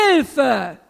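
loud falling shout; forceful demonstrated cry; screamed cry for help. Shown as a demonstration. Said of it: "Deshalb schrei ich".

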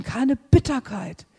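ordinary delivery

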